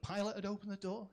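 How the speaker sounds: ordinary talk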